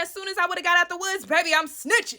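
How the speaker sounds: usual speaking voice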